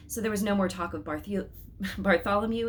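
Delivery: plain reading voice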